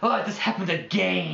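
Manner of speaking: hammed-up lament, high and loud; dramatic. Mocking how "again" sounds, high melodrama. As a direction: wail it theatrically, stretching the last word. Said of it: "dramatic when you say it like that".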